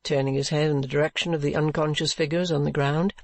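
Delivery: ordinary delivery, nothing marked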